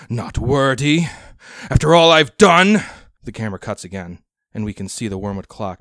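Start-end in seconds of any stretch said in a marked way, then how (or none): 0.1-2.8 much louder, higher, furious outburst; shaking with anger. Acted. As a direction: scream it in wounded rage. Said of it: "quivering with rage".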